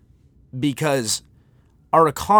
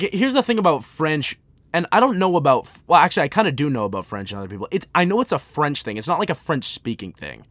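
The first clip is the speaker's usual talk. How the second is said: ordinary talk, nothing marked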